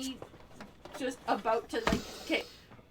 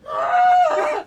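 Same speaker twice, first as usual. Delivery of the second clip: much higher, loud squeal of panic; fan squeal; 16 dB louder than usual. Retold or imitated. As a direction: let out a starstruck squeak. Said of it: "I'm freaking out".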